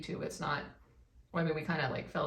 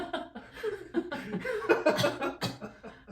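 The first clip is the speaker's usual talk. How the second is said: real high-pitched laugh; cracking up high; 12 semitones higher than usual. Real, not acted.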